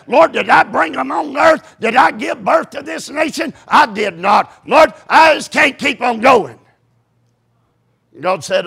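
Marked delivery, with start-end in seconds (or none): 0.1-6.6 higher, emphatic protest to God; exasperated Moses. Acted. Preacher as overburdened Moses complaining to God, exasperated. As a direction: complain loudly, at the end of your rope. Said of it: "God just heard Moses say".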